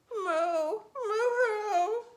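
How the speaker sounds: high, wailing moo with a sob in it; a cow mooing through tears; crying cow. A narrator's reading. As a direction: moo like a heartbroken cow, drawn out slow. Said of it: "he was surprised to see a cow crying".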